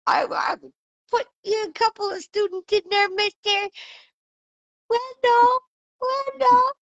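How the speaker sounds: very high, silly mock voice; hammed-up high-pitched character voice; put-on falsetto. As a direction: squeak it out in a joke falsetto. Joking put-on voice playing someone.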